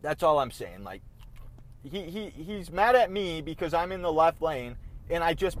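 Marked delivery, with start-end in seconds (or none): none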